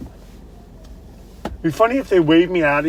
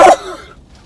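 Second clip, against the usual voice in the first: gagging; 10 dB louder than usual. Real, not acted. Gagging from the nose swab.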